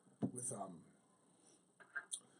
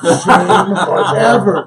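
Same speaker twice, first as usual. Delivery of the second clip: laughing; 10 dB louder, much slower than usual. Retold or imitated. Mocking a fighter's exit.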